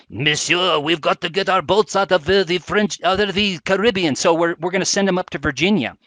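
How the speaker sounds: put-on jokey French accent, French sailors hurrying their boats away; bad French accent. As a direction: ham up a French officer's accent, badly. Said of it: "Very bad French accent there".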